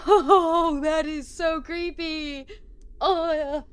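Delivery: loud, high, drawn-out freak-out; creeped-out wail. Real, not acted. Player reacting to creepy computer message, high loud creeped-out wail. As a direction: wail it out, half laughing with nerves.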